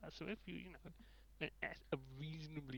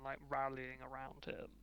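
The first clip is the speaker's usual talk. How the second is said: normal talking voice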